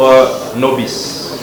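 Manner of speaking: normal talking voice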